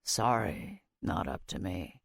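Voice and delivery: low, soft and pitying. A narrator's reading. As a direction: offer quiet false sympathy. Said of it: "in what sounded like a pitying voice".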